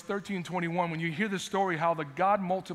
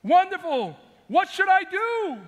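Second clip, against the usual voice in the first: grab at the rescue eagerly; eager relief; 11 semitones higher, 7 dB louder than usual. Retold or imitated. Man on the branch answers God, high and eager. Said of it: "Then he goes".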